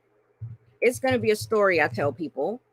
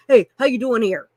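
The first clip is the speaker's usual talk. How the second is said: pushy stranger; 7 dB louder, faster than usual. Retold or imitated. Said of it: "somebody stops you ... and says".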